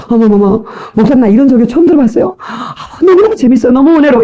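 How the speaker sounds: high, hammed-up admirer; imitated rising wonder and delight; amazed gushing. Churchgoer praising the pastor's sermon, gushing with amazement. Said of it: "여러분 보세요".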